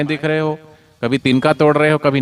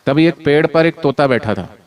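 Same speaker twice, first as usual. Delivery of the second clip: normal talking voice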